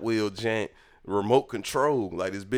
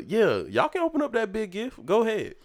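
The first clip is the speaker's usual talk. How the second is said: high-pitched mock mother voice; imitated mom; 13 semitones higher than usual. Mom letting kids open the big gift, imitated. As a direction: put on a high mom voice granting permission.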